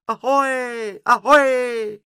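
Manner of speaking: loud, very high bird cry; shrill screech. A narrator's reading. Karel calls from above their heads, shrill screech. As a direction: screech a long greeting from overhead. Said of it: "een schel gekrijs".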